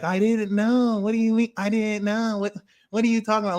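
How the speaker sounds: high mock innocent voice; mocking Aquarius. Mocking Aquarius who plays innocent. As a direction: play dumb, high and fast.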